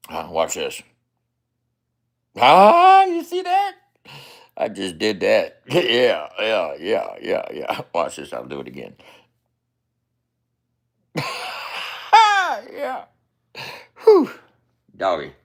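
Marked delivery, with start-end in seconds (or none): 2.4-3.7 high and loud, thrilled at what he just did; high excited look-at-that. Real, not acted. Showing off a move. 11.1-12.7 squeaky high burst of laughter; loud high cackle. Real, not acted. Laughing at own trick.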